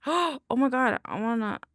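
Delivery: ordinary talk, nothing marked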